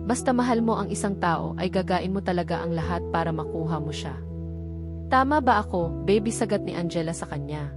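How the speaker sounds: usual speaking voice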